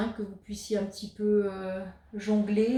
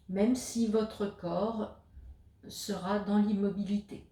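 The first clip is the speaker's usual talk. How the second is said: guided meditation; 3 dB quieter than usual. Acted. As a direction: guide the listener into stillness, leaving space between phrases. Guides a yoga nidra session. (voix posée de méditation guidée, avec de longues pauses)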